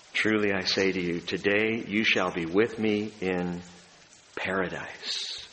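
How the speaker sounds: a hushed, tender promise of paradise. Retold or imitated. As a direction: promise it gently, slowing down. Jesus answering the thief.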